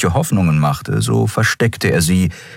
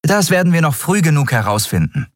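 promising; 11 semitones higher than usual. Acted. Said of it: "versprach Endriel".

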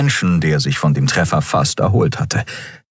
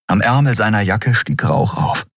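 plain reading voice

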